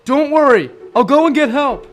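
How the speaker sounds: calling out reassurance. Acted. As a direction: shout help is coming to those trapped. Calling to trapped friends from outside.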